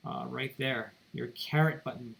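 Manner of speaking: ordinary talk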